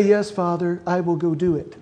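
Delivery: a hint of a dutiful son's false promise; mock obedient reply. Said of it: "if you stand there and say".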